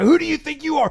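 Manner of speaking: high, shouted whine, thrice over; mock shouting agent. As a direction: shriek it like a panicking agent. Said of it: "the federal agent's like".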